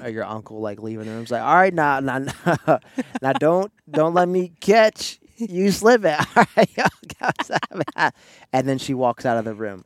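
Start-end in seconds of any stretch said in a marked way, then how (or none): 1.4-8.1 loud, high hammed-up aunt farewell; auntie exit voice. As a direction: play the aunt leaving the party. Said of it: "makes it seem like your aunt".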